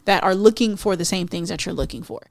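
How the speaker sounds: ordinary delivery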